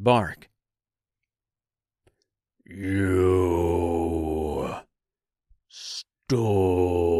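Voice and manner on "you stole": low, drawn-out monster voice, word by word; deep thunderous tree. A narrator's reading. Angry tree accusing Dorothy of theft, deep and thunderous. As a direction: boom it out slowly and deep, like an accusing giant. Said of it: "in a deep, thunderous voice".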